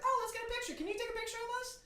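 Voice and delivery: very high mock mom voice; hammed-up high-pitched mom. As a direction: squeak it like an eager mom.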